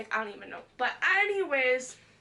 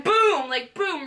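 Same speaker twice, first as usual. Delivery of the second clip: loud, sudden boom; mimicked impact; 5 semitones higher, 10 dB louder, much slower than usual. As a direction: punch out each boom like a blow. Mimics the blow to head.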